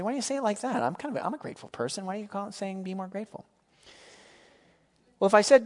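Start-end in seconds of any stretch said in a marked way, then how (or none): 0.0-3.4 high, quick mock protest; objecting listener. Congregant questioning the preacher's admonition, in mock protest. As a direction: voice a put-out listener, higher and hurried.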